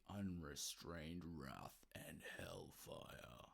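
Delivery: slow, menacing whisper; ominous whisper. Acted. Reading the tour hype to fans, slow ominous whisper. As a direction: breathe the threat out slowly. Dramatic reading of press release.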